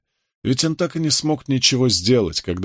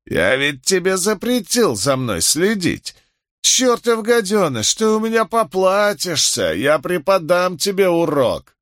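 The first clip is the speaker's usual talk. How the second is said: put-on high-pitched, raging drunken bully; worked-up, high-voiced tirade; furious threat; 8 semitones higher than usual. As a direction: threaten him in a high, angry voice. Drunk Osley attacks with cane.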